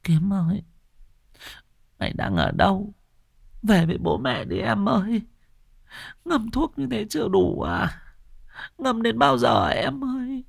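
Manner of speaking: grieving sister calling her drowned brother, tearful; tearful plea. Acted. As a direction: sob it out, begging him to come home. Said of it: "nước mắt lã chã, mồm thều thào".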